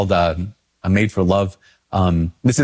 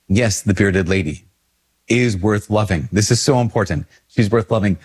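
ordinary talk

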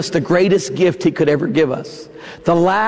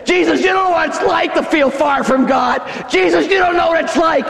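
accusing complaint; 8 semitones higher than usual. Retold or imitated. Voicing a complaining listener.

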